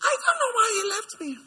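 high, tearful mock lament; mock wailing. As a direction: wail it like a jilted lover, hammed up. Mimics a jilted person wailing.

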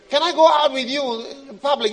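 high, loud, fast eager begging; pleading believer. Acted. Believer begging God for closeness, pleading fast. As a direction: rush out the plea, desperate to be wanted.

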